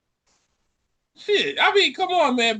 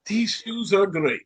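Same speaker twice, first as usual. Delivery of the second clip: slow mock-confident verdict; old-man confidence; slower than usual. Imitating a professor praising his shoes, slow mock confidence. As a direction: say it slowly, imitating a sure-of-himself old man. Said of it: "with the confidence of old man youth".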